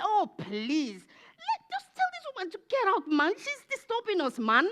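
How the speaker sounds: very high, whiny complaining voice; high-pitched mock exasperation; annoyed disciples. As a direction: squeal it like a pestered follower. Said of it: "The disciples said".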